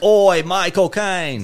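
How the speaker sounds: high, slow, hammed-up Cockney cry; mock Cockney accent. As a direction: exclaim it in a stretched comic British accent. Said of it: "I said it in a better accent".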